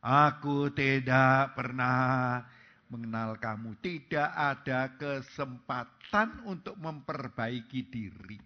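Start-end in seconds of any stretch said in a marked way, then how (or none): 0.0-3.8 drawn-out louder verdict; final judgment, slowly; slow, weighty pronouncement. Acted. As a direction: stretch every word of the sentence. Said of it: "Tuhan akan terus terang berkata".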